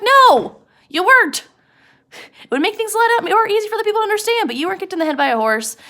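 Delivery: high, loud, annoyed correction; exasperated denial. Acted. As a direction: snap back at him, pitch way up. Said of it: "He's so mad at him".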